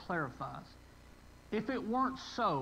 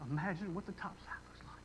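breathy hushed whisper, almost no voice; 8 dB quieter than usual. Acted. Pastor hushing the congregation, whispered in awe. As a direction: let the line fall to a hush. Whispered punchline of child's story.

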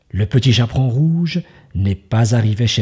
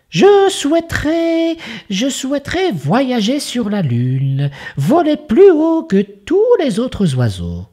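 much higher, chirpy little bird; a tiny bird dreaming of the moon; 14 semitones higher than usual. Acted. Bird makes a wish.

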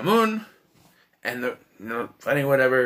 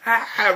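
imitating a pygmy screaming back; mock scream; 7 semitones higher than usual. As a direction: answer the scream with a startled shriek of your own. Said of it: "The Pygmy screams".

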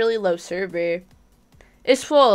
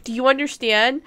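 normal talking voice

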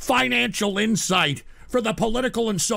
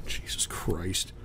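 softer, low, unvoiced and slow; whisper; 12 dB quieter, slower than usual. Real, not acted. Worn-out streamer after a rant, whispered. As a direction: breathe it out exhausted.

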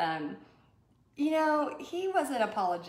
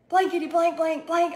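higher, louder mock rant of bleeped curses; imitating a furious golfer; 9 semitones higher, 7 dB louder than usual. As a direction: ham up an angry golfer's cursing. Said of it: "so mad".

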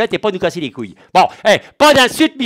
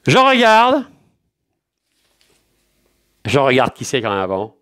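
ordinary talk, nothing marked

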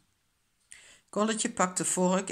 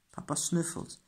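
usual speaking voice

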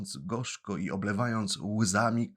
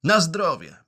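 put-on puppet boy blessing; 9 semitones higher, 8 dB louder than usual. Pinocchio answers the sneeze.

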